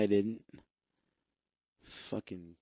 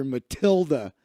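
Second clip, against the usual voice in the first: ordinary talk, nothing marked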